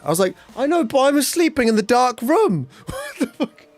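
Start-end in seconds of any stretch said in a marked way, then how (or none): 0.5-2.7 very high-pitched indignant protest; mock-outraged, voice shooting right up; exasperated outburst. Retold outburst at partner over lights. 2.9-3.6 very high, strangled mock bewilderment; tiny falsetto-like incredulous squeal; squeaky disbelief. Retold disbelief after the outburst.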